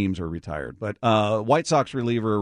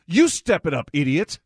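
higher, louder mock-angry jab; imitated anger; 7 semitones higher, 5 dB louder than usual. Co-host mimicking his partner's angry reading, mock anger. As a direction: throw the insult back with exaggerated indignation. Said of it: "Like the anger you put on that".

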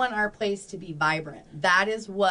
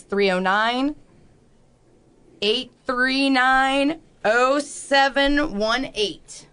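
slow dictation; 3 semitones higher, 4 dB louder, much slower than usual. Shown as a demonstration. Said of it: "Let me say that again".

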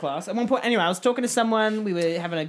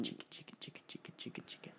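unvoiced rhythmic chicka chicka; mock noise whispered in the corner; 10 semitones lower, 27 dB quieter, much faster than usual. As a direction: whisper it like a camera shutter. Heckling from the corner.

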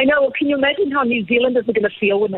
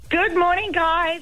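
bright-and-early chirpy greeting, high-pitched; high chirpy morning hello; 8 semitones higher, slower than usual. Real, not acted. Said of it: "How are you so chirpy".

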